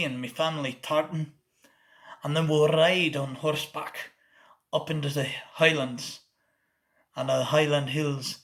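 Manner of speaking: deep Scottish accent. Shown as a demonstration. Tour host boasting of Wallace ancestry, in a Scottish accent. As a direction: deepen and slur it like a proud Scotsman. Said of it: "we're going to deepen it a little, and we're going to slur it a little".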